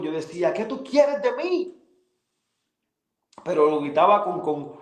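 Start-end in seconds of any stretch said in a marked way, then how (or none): 0.5-1.7 high, slow, pleading cry toward God; retold desperate cry. Alone on the floor, crying out to God in despair. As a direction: relive the desperate outcry, pitched up. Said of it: "en ese grito de desesperación".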